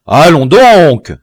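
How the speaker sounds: loud, slow, angry outburst; impatient anger. A narrator's reading. Biassou snaps at the frozen prisoner, loud and angry. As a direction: bark it out, losing patience. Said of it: "d'un ton d'impatience et de colère".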